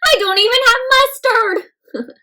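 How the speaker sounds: high, loud pleading dinosaur; a high, loud voice for a dinosaur calming a deer; Penelope voice. Acted. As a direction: reassure the frightened deer brightly. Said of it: "Penelope says".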